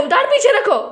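high exasperated call to her son; sharper repeat. Real, not acted. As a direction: raise the pitch as you repeat the order. Repeats the order much higher.

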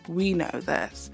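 usual speaking voice

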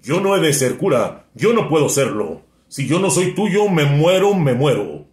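declaimed lover's despair, pitched high; impassioned plea. Acted. Seminarian pleading his love to the girl, impassioned. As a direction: plead in rising waves, peaking then dropping on the last words.

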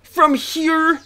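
very high, loud protest; exasperated shout. Real, not acted. Player protesting to the game, exasperated shout. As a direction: shout it in disbelief, stretching the end.

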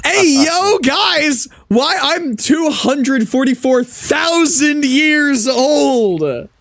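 high, loud amazed exclamation; excited. Real, not acted. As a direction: shout it out in disbelief. Reacts to absurd in-game age.

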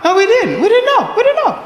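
very high, squeaky mock protest; hammed-up high defensive denial; panicked denial. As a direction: plead innocence, pitch climbing way up. Protests to accusing girlfriend.

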